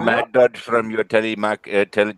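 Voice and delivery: put-on telemarketer in an Indian accent. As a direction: put on an Indian call-centre voice. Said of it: "that was a fucking amazing Indian accent".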